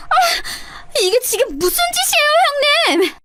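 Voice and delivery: high-pitched shriek of shock and pain. Acted. Sister-in-law shrieks at the narrator after being slapped, high and shrill. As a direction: shriek as the slap lands.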